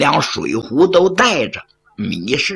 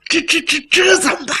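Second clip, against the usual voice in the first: 结巴着，声音猛地拔高; 急得团团转地连声问; 慌了神; 12 semitones higher, faster than usual. Acted. 米氏吓得没主意. (惊慌失措地拔高声音)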